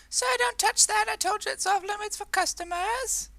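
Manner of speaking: very high, hammed-up frightened shopkeeper; petrified cry. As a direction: cry out in panicked falsetto. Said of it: "The petrified voice of the shop owner cries out once more".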